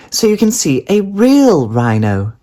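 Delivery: high, fast bright mother voice; cheery mum. A narrator's reading. Mum telling Daisy the surprise, high and fast. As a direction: announce it like a chirpy mum.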